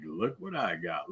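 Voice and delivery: low, sly half-whisper tease; teasing. Retold or imitated. Teasing his friend over a video of the gift, sly quiet murmur. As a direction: dangle it quietly, like a secret bait. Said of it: "I teased him".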